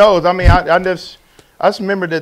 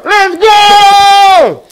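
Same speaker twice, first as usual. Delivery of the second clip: hype shout; 11 dB louder than usual. Acted. Hype cheer.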